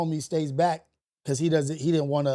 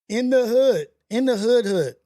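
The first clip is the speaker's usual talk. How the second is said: emphatic call out; 5 semitones higher, 5 dB louder than usual. Real, not acted. Telling the listener where it happened, loud and emphatic. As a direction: belt it out like a chant. Stressing the dangerous neighborhood.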